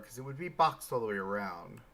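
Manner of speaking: usual speaking voice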